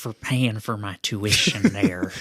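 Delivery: lightly voiced old man at school; a hint of old-timer voice. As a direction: play an old guy heading back to school. Acting Denny as an old man.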